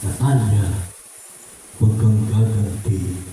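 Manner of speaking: low, gentle but firm divine warning; voice of the Lord. Retold or imitated. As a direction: speak as God counselling a child, low and steady. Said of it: "Sasabihin ni Lord". (Diyos na mahinahong nagbababala, mababa ang boses)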